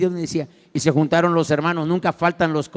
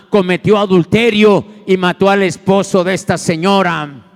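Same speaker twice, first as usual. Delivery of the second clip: loud, driving sermon voice; preaching at full force; 7 dB louder than usual. Acted. Preacher thundering to the church about David's sin.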